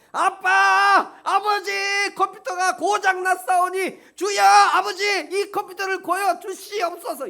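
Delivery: high, loud, hammed-up cry over a broken computer; mock wailing prayer. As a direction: overdo the desperate shout. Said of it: "이렇게 기도하는 사람 어디냐고요?". (컴퓨터 때문에 아빠에게 과장되게 울부짖는 기도)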